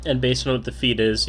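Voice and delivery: ordinary talk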